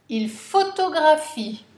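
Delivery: « photographie » accentué, fort et aigu; emphatic dictation. Shown as a demonstration. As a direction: punch photographie loud and high. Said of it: "N'oubliez pas le E muet".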